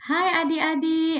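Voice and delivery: put-on high, sing-song greeting to small kids; preschool teacher voice. Shown as a demonstration. Lecturer demoing how she'd greet little children. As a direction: greet them like a kindergarten teacher. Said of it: "Nak kena macam".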